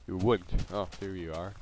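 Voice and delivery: ordinary talk, nothing marked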